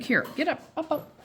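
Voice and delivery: high, eager coaxing; coaxing dog up. Real, not acted. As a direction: urge the dog up brightly. Coaxing dog onto table.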